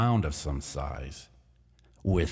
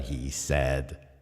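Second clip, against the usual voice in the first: ordinary delivery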